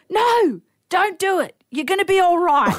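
high-pitched mock yell; retold yelling. Man on the beach calling to someone entering the water, yelling. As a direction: imitate a man yelling out a desperate warning. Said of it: "this man starts yelling".

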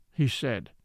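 plain reading voice

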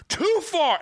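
very high-pitched mock yell; angry heckler. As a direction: imitate a heckler escalating his protest. Retold heckler protesting again.

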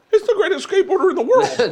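high-pitched starstruck exclamation; hammed-up awe, pitch way up; mock fan gushing. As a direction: gush like an overexcited fan. Acts out wished-for starstruck fan.

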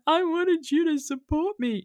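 high, drawn-out mock wail; imitated crying. Wife retelling her plea to her husband, mock crying. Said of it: "and then I'm crying".

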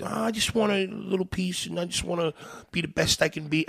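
high, soft, falsely modest little voice; mock humble voice. Comic mocking aspiring comics who claim humility, soft mock-humble voice. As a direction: play it sweet and self-deprecating, mocking. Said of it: "any humility in that".

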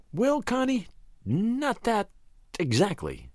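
high, soft, demure woman's voice; softly hushed and hesitant; demure whisper. A narrator's reading. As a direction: hold back coyly, high then dropping. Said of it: "she whispered back demurely".

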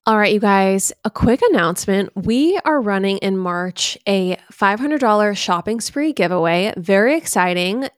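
hyped podcast announcement; ad read. Acted. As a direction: sell the giveaway like a host. Giveaway ad read.

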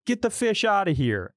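mocking. Mocking dad with fake curse.

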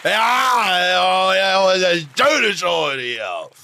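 put-on loud, high drunken bawling with long drawn-out words; total betrunken. Podcast host opens the Oktoberfest show for the guest, acting totally drunk. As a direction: bellow it out like a plastered festival reveller. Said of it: "so reden, als wäre er total betrunken".

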